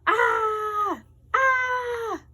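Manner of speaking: very high, loud furious scream. Acted. Taylor screaming at her brother, furious shriek. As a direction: scream it at full force. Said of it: "Taylor screamed louder".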